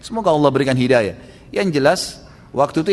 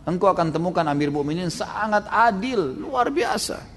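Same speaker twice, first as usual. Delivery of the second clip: retold admiration; 6 semitones higher than usual. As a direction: marvel in a higher pitch. Vouching warmly for the caliph.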